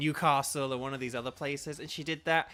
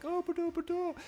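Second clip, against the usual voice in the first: friendly impression. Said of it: "do a good impression of them".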